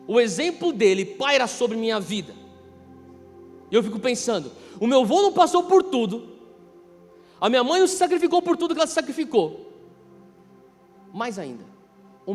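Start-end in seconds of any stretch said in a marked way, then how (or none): none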